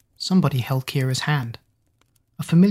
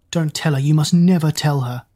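ordinary delivery

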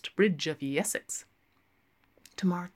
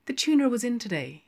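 barmaid voice; 7 semitones higher, 4 dB louder, faster than usual. Acted.

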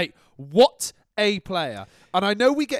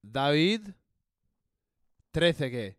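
Spanish accent; slower than usual. Shown as a demonstration. Host tries the name in a Spanish accent for listeners. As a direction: lean Spanish on "Trezeguet". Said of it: "tried ... with both a Spanish sounding and".

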